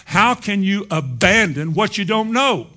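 loud, high-pitched pulpit challenge; forceful preaching. Real, not acted. Preacher challenging the congregation to deny self, forceful preaching.

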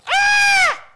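loud high yelp of fear; frightened cry. Retold or imitated. Disciples in the storm, loud frightened yelp. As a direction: shriek like a sailor seeing a ghost. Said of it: "and they went".

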